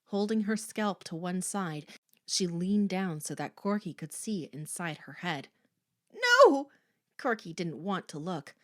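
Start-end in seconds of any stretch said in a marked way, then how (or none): 6.1-6.7 high, loud cry of dread; panicked, sharp high yell; horrified refusal. A narrator's reading. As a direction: recoil and cry out. Corky refuses to look, terrified.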